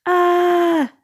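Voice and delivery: high, loud panicked scream; yelping before the jolt. A narrator's reading. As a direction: cry out as the shock hits. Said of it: "screamed Lightning".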